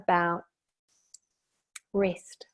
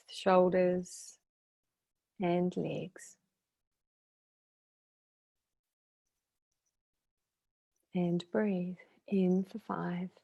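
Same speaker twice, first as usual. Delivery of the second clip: calm guidance fading to a near-whisper; guided meditation. Shown as a demonstration. Meditation leader easing listeners through hips and legs, calm guided meditation. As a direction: let phrases settle into long silences.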